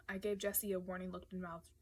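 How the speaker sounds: ordinary delivery, nothing marked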